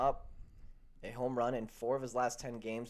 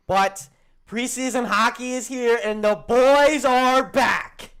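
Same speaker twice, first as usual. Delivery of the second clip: high, loud, drawn-out hype shout; hyped announcement; 11 semitones higher, 14 dB louder, slower than usual. Real, not acted. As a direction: belt it out like a pumped-up fan. Hyped announcement that hockey returns.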